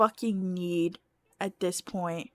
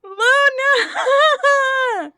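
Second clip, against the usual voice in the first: loud laughing shout of the name; yelling at the cat; 14 semitones higher, 10 dB louder than usual. Real, not acted. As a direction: call the cat out sharply, half laughing.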